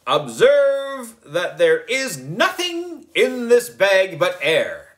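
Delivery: high, slow showman's announcement; grand wizard voice. A narrator's reading. Boy wizard showing the kids the empty bag, slow grand announcer voice. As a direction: proclaim it like a stage magician. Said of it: "I said".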